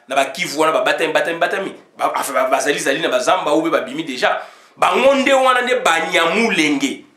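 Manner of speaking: usual speaking voice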